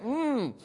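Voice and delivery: high hummed hint; imitated humming. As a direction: hum pointedly as a correction. Wife humming a correction.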